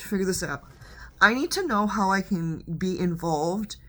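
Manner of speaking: normal talking voice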